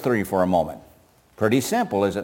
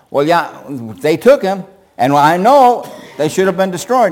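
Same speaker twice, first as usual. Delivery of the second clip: Saul backpedaling; 5 semitones higher, 5 dB louder than usual. Acted. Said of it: "Notice the addition here".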